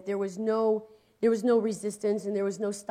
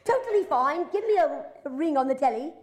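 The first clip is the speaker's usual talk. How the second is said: higher, louder cockney with a laugh; cheeky cockney callback, chuckling; cockney accent; 7 semitones higher, 4 dB louder than usual. Acted. Young [name] calls Butler back, hamming up a cockney accent. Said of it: "I just did a little cockney thing".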